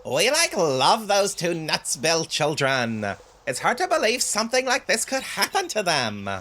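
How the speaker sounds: hammed-up villager with lifted, swooping pitch; mock-concerned villager, like, upspeaking. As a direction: play an overdramatic game villager. Voices a villager's game dialogue.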